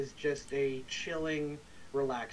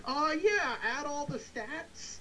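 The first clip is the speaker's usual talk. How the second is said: mock cheerful; 12 semitones higher, slower than usual. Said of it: "We're just like".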